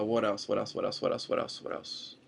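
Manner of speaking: repeated under the breath, fading away; trailing-off mutter. Real, not acted. Vlogger trying to remember what else happened, fading mutter. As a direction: fade out while searching your memory.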